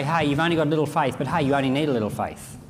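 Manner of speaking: quick, offhand mock quote; breezy mock version of Jesus; mocking paraphrase. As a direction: toss it off fast and casual. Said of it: "Did he say".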